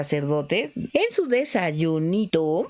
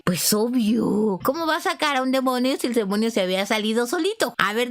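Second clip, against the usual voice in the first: normal talking voice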